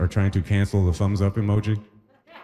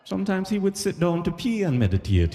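tour guide voice; 11 semitones higher than usual. Retold or imitated. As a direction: lift it up like a pious museum guide.

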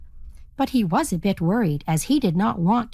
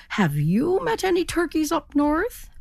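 turkey voice; 8 semitones higher than usual. A narrator's reading. Turkey asking raven.

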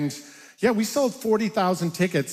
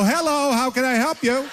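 polite phone answer; 7 semitones higher than usual. Retold or imitated. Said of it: "And then I go".

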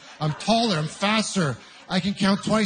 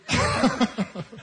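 real laugh; 5 semitones higher than usual. Real, not acted. Laugh alone on line.

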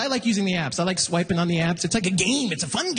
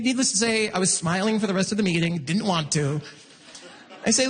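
ordinary talk

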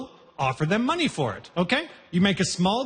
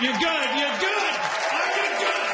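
rising pitch with each repeat; calling out to the crowd; 5 semitones higher than usual. Acted. Comic greeting the Winnipeg audience, calling out excitedly. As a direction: hype up the crowd, higher each time.

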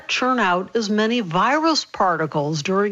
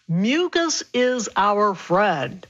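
a deliberate line for listeners to repeat; slow repeat-after-me; much slower than usual. Shown as a demonstration. Doctor asking listeners to repeat after her, very slow and deliberate. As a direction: say it very slowly, word by word. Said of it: "so repeat after me".

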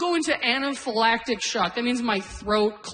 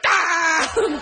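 loud, high acted yell of pain; short mock scream after getting hurt; 7 semitones higher, 4 dB louder than usual. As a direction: cry out "Ah!" sharply on higher pitch. Acts out crying out in pain.

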